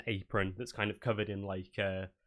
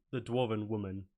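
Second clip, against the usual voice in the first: ordinary talk, nothing marked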